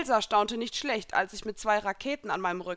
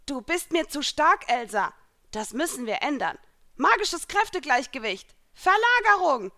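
raised voice building to a high yell; shouting; 7 semitones higher, 7 dB louder than usual. A narrator's reading. Heroine casting balancing magic on Elsa, rising shout. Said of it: "schrie ich".

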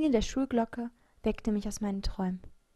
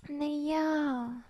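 high, drawn-out hedging; hesitant murmur; 6 semitones higher than usual. A narrator's reading. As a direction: stall awkwardly before confessing. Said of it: "murmelte ich". (zögerlich gemurmelt)

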